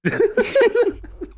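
short high cackle; high laugh. Real, not acted. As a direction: cackle briefly. Laughs at joke.

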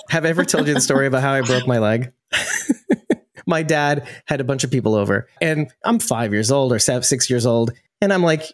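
normal talking voice

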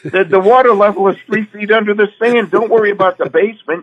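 high and loud, laughing it off; laughing reassurance. Retold or imitated. Husband to worried wife on the phone: high, loud, laughing. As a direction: tease her worry away.

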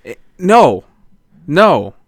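loud, high indignant refusal; angry rant. Real, not acted. Co-host objecting to pickles on a sandwich, angry. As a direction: put your foot down, loud and emphatic. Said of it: "It's so angry".

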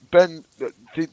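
normal talking voice

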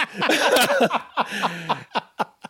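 delighted real laughter; high laugh. Real, not acted. Laughs at the story's twist.

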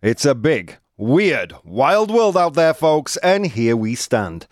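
ringmaster-style radio opening; show announcer. Acted. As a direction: announce the show, pitch climbing toward the title.

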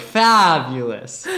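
slow, stretched fabulous; drawn out. Shown as a demonstration. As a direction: stretch out the word happily. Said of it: "elongate a certain word when you're happy".

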